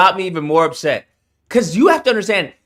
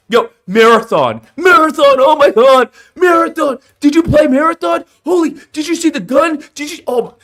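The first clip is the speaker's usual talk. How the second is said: much higher, louder fake hype; mock a streamer hyping every flop; breathless over-the-top mock enthusiasm; shilling influencer impression; 7 semitones higher, 4 dB louder than usual. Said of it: "they tell everyone how awesome the game is".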